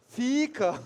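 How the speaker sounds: high, loud mock command; comic exasperated shout; loud urging. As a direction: call out for him to stay. Acted plea to departing man.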